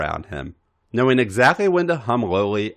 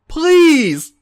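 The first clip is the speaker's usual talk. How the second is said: high, loud, desperate pleading cry; a high strained plea; pleading groan; 9 dB louder than usual. A narrator's reading. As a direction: beg out loud, breathless with need. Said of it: "A J groaned".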